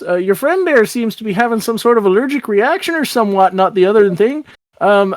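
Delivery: halting small-town lilt; folksy Irish-Canadian officer, puzzled. Acted. Town guardian confused by kids walking off, folksy puzzlement. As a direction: ramble politely while stopping them. Tracy stops departing kids.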